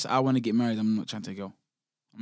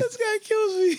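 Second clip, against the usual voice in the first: laughing; slower than usual. Real, not acted. Friend cracking up at stories.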